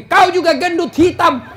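high, loud, laughing mock scolding; wife shouting back at her husband in a quarrel. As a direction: throw the insults back in a comic spat. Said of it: "Nabi bilang juga istrinya".

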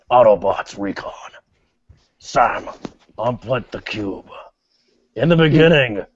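low robot-leader impression; Optimus Prime. Leader addressing Autobots and Sam, low and halting. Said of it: "Let me do it the way it's done".

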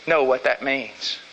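ordinary talk, nothing marked

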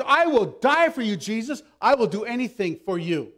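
loud, high opening pledge; emphatic vow. Acted. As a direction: swear devotion at full volume. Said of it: "he was so emphatic".

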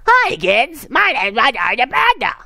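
very high cartoon mascot voice; Roger the Panda. Reviewer mocking the bear's voice to viewers, squeaky mascot impression. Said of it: "the bear's voice".

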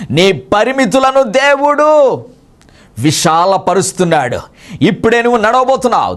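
fast, high preaching proclamation; prophetic declaration. Acted. Preacher prophesies healing over sister Susheela, fast and fervent. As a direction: declare healing with rising fervor.

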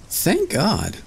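high, relieved little voice; relieved Tom Thumb. A narrator's reading. Tom Thumb finding the snail shell, high relieved voice. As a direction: sigh of thanks in a tiny high voice.

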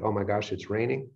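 understated letdown at the rain; mild mock dismay. Finding rain when none was supposed to fall, mildly dismayed.

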